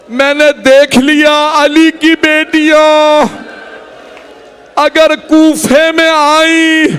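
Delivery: high, very slow keening lament; lamenting wail. Acted. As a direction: stretch each word out as if weeping.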